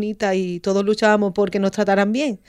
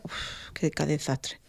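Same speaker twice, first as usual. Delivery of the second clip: weary sigh; 3 semitones lower, 10 dB quieter, much slower than usual. Real, not acted.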